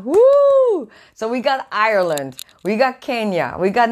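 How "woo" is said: high whooping cheer; loud excited woo. Real, not acted. Cheering viewers joining from Kenya, loud happy whoop. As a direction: let out a joyful whoop.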